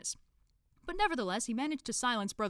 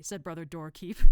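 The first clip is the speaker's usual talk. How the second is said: plain reading voice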